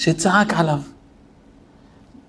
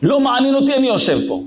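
retold yell; 8 semitones higher, much faster than usual. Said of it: "צרח עליו".

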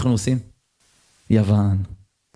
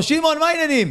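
raised call to a friend; cheerful shout across the street; 10 semitones higher than usual. Retold or imitated. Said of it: "ואני צועק לו".

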